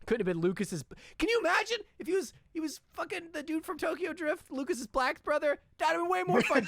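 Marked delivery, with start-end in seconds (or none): 1.2-6.7 very high, fast excited gush. Real, not acted. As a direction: gush like a thrilled fan. Giddy riff imagining a recast.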